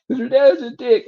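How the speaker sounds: very high-pitched mock tearful complaint; fake crying. Mom blaming dad as a prank, mock crying. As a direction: imitate a mom pretending to sob. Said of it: "made it sound like she was fucking crying".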